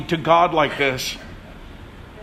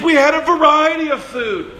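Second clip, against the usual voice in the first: high mock whine on we had a variety of food; 7 semitones higher than usual. Preacher mimics Israelites longing for Egypt's food, high whine. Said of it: "they're crying".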